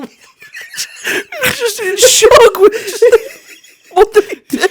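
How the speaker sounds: high, loud, slow laugh-crying words; shrieking with laughter. Real, not acted. Recalling the hedgehog shock to a friend, shrieking laughter. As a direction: howl it out half laughing half crying.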